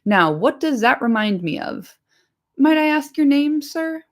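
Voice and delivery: fumbling for a memory; puzzled landlord. Acted.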